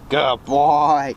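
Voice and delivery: reward the dog gently; praising a dog. Real, not acted. Praising dog after sit.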